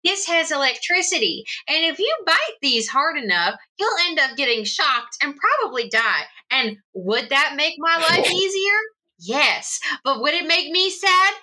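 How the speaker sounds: high, swooping sing-song lecture; over-enunciated playful threat; mock scolding. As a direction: warn naughty pets with exaggerated rises and falls. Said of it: "I go".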